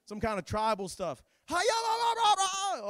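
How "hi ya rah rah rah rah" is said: much higher, wild chanting cries; mocking imitation of crazed worshippers. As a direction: ham up a frenzied ritual chant. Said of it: "all kind of crazy stuff".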